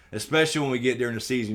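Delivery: ordinary talk, nothing marked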